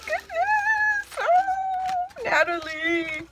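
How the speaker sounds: very high, drawn-out squeal of delight; slow, soaring excited gush; overwhelmed gushing. Real, not acted. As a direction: squeal it to the friend who made the gift. Overwhelmed by friend's handmade gift.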